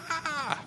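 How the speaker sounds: mock jump-scare yelp, high and soft; startled shriek. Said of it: "Khalees went".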